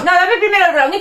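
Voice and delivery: insistent. Real, not acted. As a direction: demand the brownie first, half joking.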